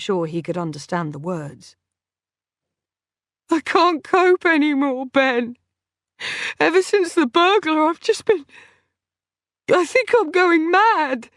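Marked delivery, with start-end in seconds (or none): 3.5-11.3 sobbing breakdown. A narrator's reading.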